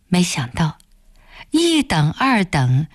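ordinary delivery

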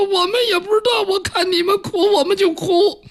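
high-pitched comic whimper; tearful palace maids. A narrator's reading. Maids outside confessing they just copy the crying, tearful. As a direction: sob it helplessly in a squeaky voice. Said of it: "他们在那抽抽搭搭的哭".

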